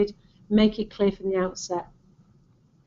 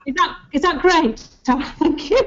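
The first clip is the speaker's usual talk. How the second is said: laughing, higher and unsure; 6 semitones higher, 4 dB louder than usual. Real, not acted. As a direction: giggly check on the pronunciation.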